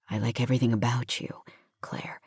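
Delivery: low, soft and quick confession; tender male lead. A narrator's reading. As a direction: murmur it low, letting the name fade. Hero tenderly confesses to heroine.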